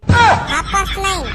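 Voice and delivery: "हाफ past nine" stretched word by word; slow correction. Acted. Repeats the slow corrected phrase.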